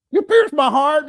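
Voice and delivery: high, loud mock woman's voice; mocking her. Quoting her accusation to the chat, in a high mock voice. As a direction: throw her line up high in a send-up.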